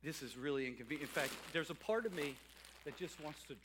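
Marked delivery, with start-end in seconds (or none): 0.0-1.1 much softer, grumbled under the breath; softer, quicker grumbling aside. Retold or imitated. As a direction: mutter it like a reluctant shepherd to yourself. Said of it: "which person wouldn't say".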